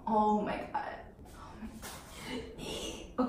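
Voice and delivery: slow whisper breaking into a laugh; whispered disbelief. Real, not acted. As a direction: mutter it under your breath, half laughing. Facing the wax strip.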